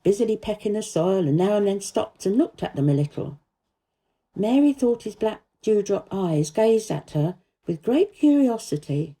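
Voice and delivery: ordinary delivery, nothing marked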